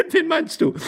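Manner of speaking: very high, laughing question; laughing lady. Acted. Lady asking her husband, giggly. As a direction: squeal the question, tickled.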